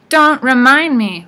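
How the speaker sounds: loud, slow, high wail. A narrator's reading. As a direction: wail it out like an old hag. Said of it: "Wasp wailed".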